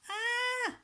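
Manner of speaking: high startled scream; shrill fright scream. A narrator's reading. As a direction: shriek in sudden fright. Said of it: "screamed Henry".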